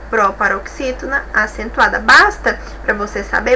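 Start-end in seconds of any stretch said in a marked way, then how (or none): none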